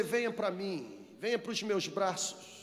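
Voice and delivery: soft, low, tender invitation; sweet, gentle voice. Acted. Preacher voices Jesus calling the downcast to him, tenderly. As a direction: draw them in quietly and soothingly. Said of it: "a voz dele é doce, é suave, é mansa".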